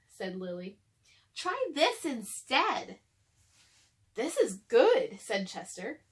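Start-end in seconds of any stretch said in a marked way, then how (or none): 1.3-3.0 higher and very slow; Lily's voice. Acted. Lily offers her breakfast. 4.1-5.2 louder and slow; pleased. Acted. Chester enjoying new breakfast.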